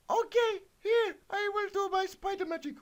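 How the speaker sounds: put-on very high, piping girly voice; squeaky Miriam the wizard. Reading the kid's story, Miriam tells the spiders in a very high squeak. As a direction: squeak it like a little pony wizard.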